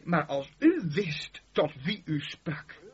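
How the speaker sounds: drawn out, weighing each word; slow, deliberate reply to the woman. Acted. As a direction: let every word land slowly. Same reply repeated, slowed.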